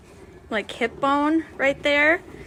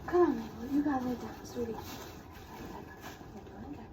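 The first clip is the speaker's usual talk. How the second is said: hushed, tender encouragement, much softer; soothing; 15 dB quieter than usual. Real, not acted. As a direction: gently reassure a laboring cow. Coaxing Mabel to lie down.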